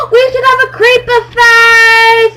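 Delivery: gasp, then high excited half-singing shout. Real, not acted. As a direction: burst out with the idea, thrilled. Excited idea during building.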